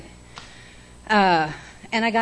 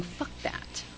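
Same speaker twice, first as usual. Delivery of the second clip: breathy under-the-breath curse; whispered aside; 15 dB quieter than usual. Real, not acted. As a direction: drop it to a whisper for the room. Aside after listing coping clichés.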